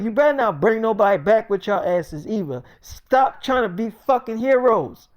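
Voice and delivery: loud, high stern shout; angry warning. Retold or imitated. Friend yelling a warning as they leave. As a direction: lay down the law.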